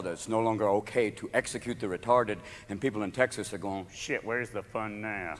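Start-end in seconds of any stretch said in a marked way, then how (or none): 3.9-5.3 low, soft, slow drawl; glum, hushed Texan drawl; disappointed Texan. Acted. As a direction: grumble it slowly under your breath.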